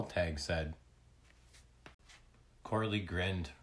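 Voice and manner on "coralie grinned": usual speaking voice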